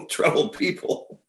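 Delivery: breathy laugh through the words. Real, not acted. Pitcher recalling odd teammates, laughing through the words. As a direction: let a laugh carry it.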